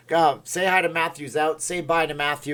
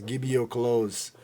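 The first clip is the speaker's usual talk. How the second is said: lightly voiced Austrian cyborg; a hint of robotic action-star accent; Arnold; 3 dB quieter than usual. Streamer quoting Terminator to viewers, understated Arnold impression. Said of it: "naked Arnold walk up to the biker and he says".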